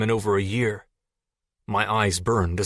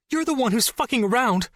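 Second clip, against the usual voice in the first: high, shrill-voiced girl yelling back through tears; tearful shout; 14 semitones higher than usual. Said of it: "Jess shouted".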